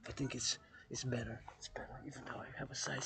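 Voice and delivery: soft unvoiced whisper; real whisper near strangers. Real, not acted. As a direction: whisper it so no one is disturbed. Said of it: "So just gonna whisper".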